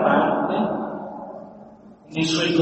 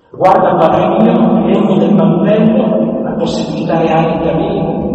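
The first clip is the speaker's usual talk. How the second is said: scripture reading; 3 semitones lower than usual. Acted. Preacher reads David pleading with Saul, mildly raised and solemn. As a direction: proclaim David's words with weight.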